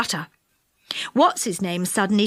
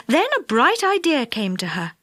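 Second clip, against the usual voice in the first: plain reading voice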